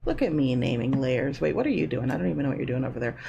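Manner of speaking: normal talking voice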